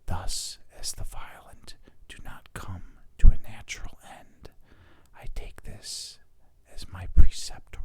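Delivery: intimate whispered reading; ASMR whisper. Shown as a demonstration. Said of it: "doing the A S M R thing".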